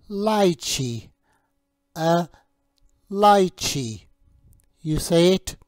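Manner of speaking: slow, clear teacher voice modelling each word; teacher drilling a young English learner on fruit names; vocabulary drill. Shown as a demonstration. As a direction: model each fruit name for a child to repeat.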